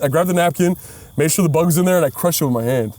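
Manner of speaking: ordinary delivery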